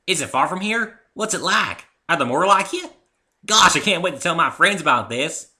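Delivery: high, loud and bubbling over; a child who can't wait to tell friends; excited little filly. Acted. Filly gushes with questions.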